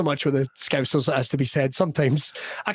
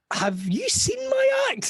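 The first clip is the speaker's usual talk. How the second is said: much higher, slow disbelief; incredulous; slower than usual. Retold or imitated. Comedian baffled by the Malawi invite, much higher incredulous voice. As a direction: squeak it out in disbelief. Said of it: "I just kept wondering".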